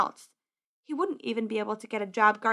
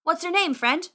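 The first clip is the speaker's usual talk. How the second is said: higher-pitched, chummy question; friendly boy wizard; 7 semitones higher, 4 dB louder than usual. Acted. Ragged wizard asking squire his name, friendly. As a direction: ask in a raised, friendly voice. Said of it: "the ragged wizard asked".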